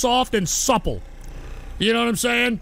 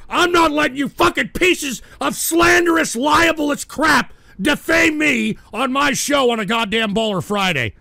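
louder, higher furious outburst; angry shout; 4 semitones higher, 3 dB louder than usual. Real, not acted. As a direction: lash out at the slander. Threatening to quit in anger.

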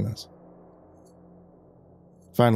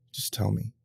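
quiet resignation; 8 dB quieter than usual. A narrator's reading. Visitor yielding to hear the truth, hushed. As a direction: give in, barely voiced. Gives in after swallowing hard.

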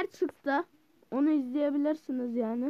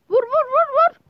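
high, loud repeated command; urgent shout; 10 semitones higher, 10 dB louder than usual. Real, not acted. Gamer urging hits mid-fight, shouting. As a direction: hammer the word out urgently.

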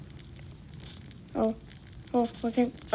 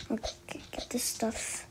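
hushed whisper to himself; 3 semitones lower, 14 dB quieter than usual. Real, not acted. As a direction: whisper under the breath. Quiet aside mid-game.

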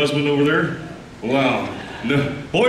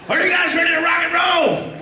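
rock show shout; 11 semitones higher than usual. Real, not acted. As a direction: pump the crowd up. Host hypes the crowd.

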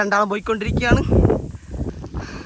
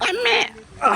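startled cry; slower than usual. Real, not acted. Jumping over mud.

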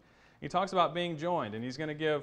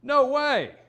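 "No way!" shouted much higher and louder; emphatic exclamation; 12 semitones higher, 11 dB louder than usual. Shown as a demonstration. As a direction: fling out "No way!" as a big emphatic exclamation. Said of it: "in our English we might say".

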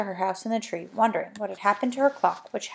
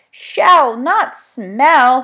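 loud with stress on "Shell" and "not"; patient correction; 4 semitones higher, 10 dB louder, much slower than usual. Acted. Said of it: "said Fanny patiently".

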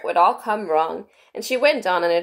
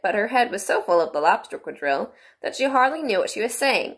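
ordinary delivery

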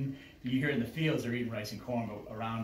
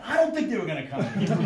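laughing; 7 semitones higher, 5 dB louder, faster than usual. Retold or imitated. Retorting with a laugh.

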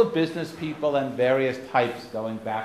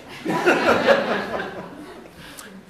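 long, loud burst of laughter; big laugh; 9 semitones higher, 6 dB louder than usual. Real, not acted. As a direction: laugh hard at the punchline. Laughing at his own punchline.

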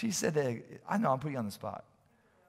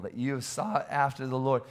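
ordinary talk, nothing marked

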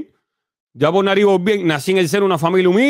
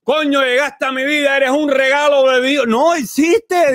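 much higher, gushing like a lovestruck fan; mock swooning falsetto; 9 semitones higher than usual. Mocking the woman in the clip with a high swoony voice. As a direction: coo it up high, all swoony. Envying the praised man, mocking voice.

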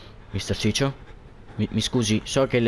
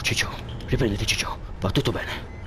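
soft whisper; 3 semitones lower, 4 dB quieter than usual. Real, not acted. Soothing the scared character.

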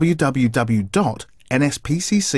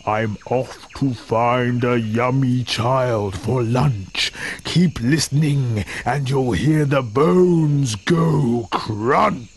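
gloating crocodile; 2 semitones lower, slower than usual. A narrator's reading. Crocodile gloats in read story.

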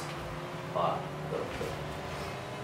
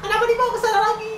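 fast, high frustrated cry; exasperated yell; much faster than usual. Real, not acted. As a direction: shriek at the teammate. Ball carried away again.

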